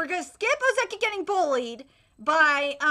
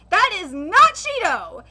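normal talking voice

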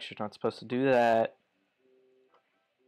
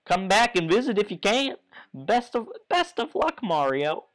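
higher, cracking goodbye; cheery farewell; 6 semitones higher than usual. Acted. Goomba father sending Mario off, voice cracking higher. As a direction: wish him luck with a squeak.

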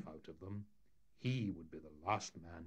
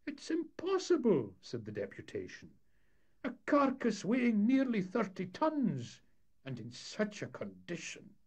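much higher, louder outcry; a raised, shocked protest; exasperated townsman; 14 semitones higher, 6 dB louder than usual. Acted. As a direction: deputation aghast at the task. Deputation protesting.

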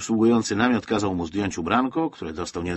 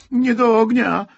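put-on high, loud child's scream of protest; desperate cry; 12 semitones higher, 7 dB louder, slower than usual. A narrator's reading. Boy sees his teddy going to the fire, desperate shout. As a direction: cry out in despair as a small boy. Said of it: "krzyknął rozpaczliwie Wojtuś".

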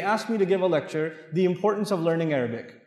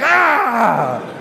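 mock wife retort; 2 semitones higher, 5 dB louder than usual. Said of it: "They'll be like".

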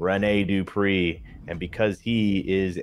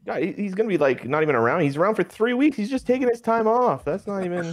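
exasperated; 12 semitones higher than usual. Real, not acted. Exasperated over injured wrestler.

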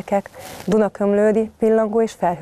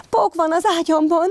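high alarmed little cub; cub crying out; 11 semitones higher than usual. Acted. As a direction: shout it like a scared child in bed. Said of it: "kiáltott fel Brumcsi".

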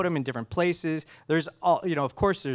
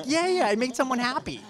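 much higher, gleeful self-quote; mock delight; 11 semitones higher than usual. Scientist replaying his joy at pleasing a colorist, much higher. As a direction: squeal like you just pleased someone.

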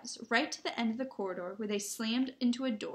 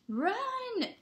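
high, drawn-out yelled "Run!"; 14 semitones higher than usual. A narrator's reading. Harry to his friends as they flee, yelled. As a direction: yell it long and high. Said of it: "yelled Harry".

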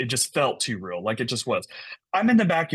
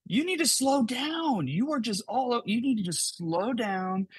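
stretched-out, pitched-up retold shout; mock yell; 10 semitones higher than usual. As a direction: imitate a contractor calling across the yard. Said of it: "And he yells".

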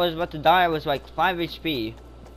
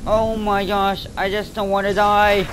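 frantic plea; 4 semitones higher, 6 dB louder than usual. Real, not acted. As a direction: drag out a scared plea.

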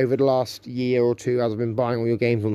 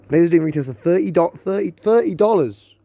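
surprised; 4 semitones higher, 4 dB louder than usual. Real, not acted. Shocked at the price.

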